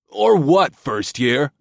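put-on high, loud jeering drunk; taunting frat boy. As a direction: sneer it like a drunk bully.

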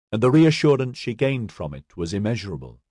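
ordinary delivery

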